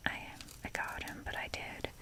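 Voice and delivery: ordinary talk, nothing marked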